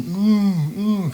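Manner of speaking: high, urgent muffled hums; imitating his father. Son retelling his mute father's worried hums. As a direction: hum like a man who can't talk. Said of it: "he's going".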